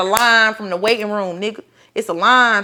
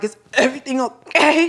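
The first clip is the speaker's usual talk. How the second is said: pitched-up checking question; imitated anxious question; 5 semitones higher than usual. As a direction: ask it high, as a retold worry. Acts her worried question mid-surgery.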